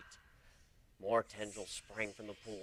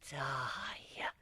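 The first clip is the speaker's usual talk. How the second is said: a single breathy unvoiced threat; creepy whisper; 5 dB quieter than usual. Demented mare whispering to her friend, creepy whisper. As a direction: whisper it with a crazy gleam. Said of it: "with a crazy gleam in her eye".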